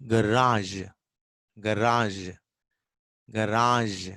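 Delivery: "garage" said as ga-RAHZH, stress on the second syllable; British garage. Shown as a demonstration. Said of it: "It is".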